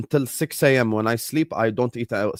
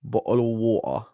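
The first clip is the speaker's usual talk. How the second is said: British "Bottle of water", the t dropped to a glottal stop and a soft r-less "wa-uh"; "Bottle of water" with British sounds, a little quieter; British pronunciation; 4 dB quieter than usual. Shown as a demonstration. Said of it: "the British pronunciation".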